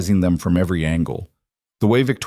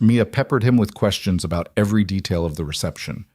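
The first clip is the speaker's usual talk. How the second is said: ordinary delivery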